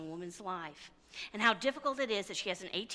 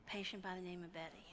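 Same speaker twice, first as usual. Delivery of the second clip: much softer, letting the words sink in; soft echoed repeat; 12 dB quieter than usual. Shown as a demonstration. Preacher softly echoing a patient's words to the congregation, hushed. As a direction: repeat it quietly to yourself.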